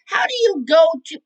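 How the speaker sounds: loud, high, baffled burst; exasperated disbelief. Real, not acted. Exasperated at the cavers' choice.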